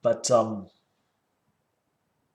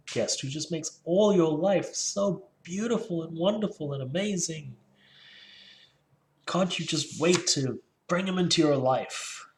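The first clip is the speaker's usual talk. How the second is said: high, gushing mock praise; sarcastic gushing, pitched up; dramatic sarcasm; 6 semitones higher than usual. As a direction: oversell it with an eye-roll. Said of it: "you probably think I'm still being dramatic".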